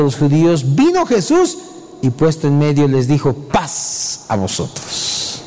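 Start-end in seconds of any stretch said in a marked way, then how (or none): 3.3-5.4 slow hushed whisper, much softer; whispered greeting. Acted. Jesus greets the frightened disciples in a slow whisper. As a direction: breathe the words out slowly.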